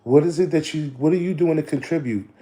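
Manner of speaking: ordinary talk, nothing marked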